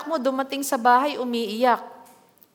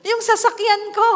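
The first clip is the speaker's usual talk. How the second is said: much higher and louder mock distress; mock wail over the bike; 9 semitones higher, 6 dB louder than usual. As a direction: cry out for your motorbike, hammed up. Parent worried about the car. (eksaheradong pag-aalala, mataas at malakas)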